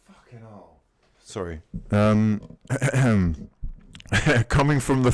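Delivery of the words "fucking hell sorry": usual speaking voice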